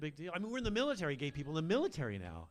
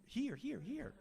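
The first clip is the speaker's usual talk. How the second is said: high pointing; 9 semitones higher, much slower than usual. Acted. Pointing out clitoris.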